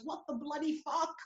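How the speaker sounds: high, quiet British outburst; British accent. Retold or imitated. British girlfriend reacting to police at gunpoint, British accent. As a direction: imitate a British friend's disbelief.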